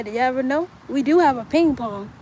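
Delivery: ordinary talk, nothing marked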